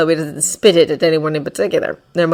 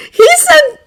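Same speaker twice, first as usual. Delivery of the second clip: choked-up crying; 12 dB louder than usual. Acted. Boy choking on furious tears.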